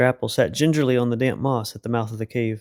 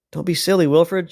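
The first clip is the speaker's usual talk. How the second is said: higher, raised scolding voice; shouted; 5 semitones higher, 4 dB louder than usual. A narrator's reading. Mister Apple shouts up at Wilfred, scolding. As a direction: scold him from below. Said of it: "shouted Mister Apple".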